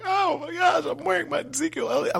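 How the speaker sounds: giddy fan. Retold or imitated. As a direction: squeal it like a starstruck fan. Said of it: "Like, I'm turned into a fan".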